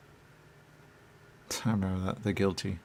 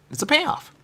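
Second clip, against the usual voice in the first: quoting someone; 7 dB louder than usual. Retold or imitated. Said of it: "I think someone said".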